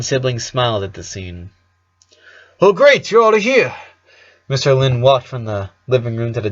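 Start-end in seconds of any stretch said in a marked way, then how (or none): 2.6-3.7 higher, louder beaming dad voice; cheerful dad. Acted. Dad greeting his kids at breakfast, beaming and higher. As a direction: burst in bright and overjoyed.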